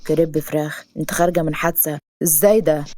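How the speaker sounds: low, dry male-lead voice; disapproving hero. Acted. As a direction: question it in disbelief. Hero questions her request.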